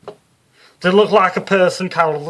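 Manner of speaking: loud, high, brassy Liverpool medium; Psychic Sue voice. Acted. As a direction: play her as a brash Scouse showwoman. Sue presses Carol.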